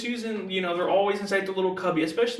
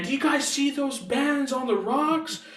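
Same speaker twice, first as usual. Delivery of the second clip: higher-pitched, drawn-out imitation of an excited yell; mock excited yelling; 7 semitones higher, slower than usual. Retelling the professor's shout to students in the van, mock excited yelling. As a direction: call it out slowly in a raised, mock-yelling voice. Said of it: "he's yelling in the van".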